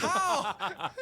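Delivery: high, hammed-up astonishment; acted disbelief. Dreamer sees Andrew alive at school, mock astonished. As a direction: demand an explanation in mock shock. Shock friend is alive, retold.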